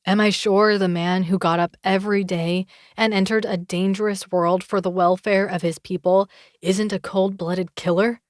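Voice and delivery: ordinary delivery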